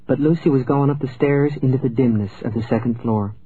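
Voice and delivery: usual speaking voice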